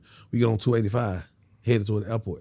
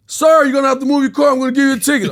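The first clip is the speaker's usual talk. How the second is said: high, loud official order; loud, high warning voice; imitating traffic officer; 12 semitones higher, 9 dB louder, faster than usual. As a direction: call it out loudly to a driver.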